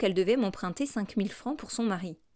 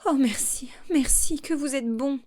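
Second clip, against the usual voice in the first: high, loud burst of thanks; overjoyed relief, voice lifted and louder; relieved exclamation; 6 semitones higher, 4 dB louder than usual. A narrator's reading. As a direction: cry out your gratitude, much higher. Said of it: "Elle s'écria".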